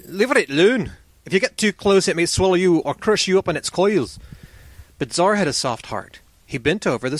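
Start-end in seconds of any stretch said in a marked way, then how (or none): 0.1-4.2 pitched up, mildly alarmed warning; urgent warning. Acted. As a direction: warn a friend away from danger. Companion warns against approaching serpent.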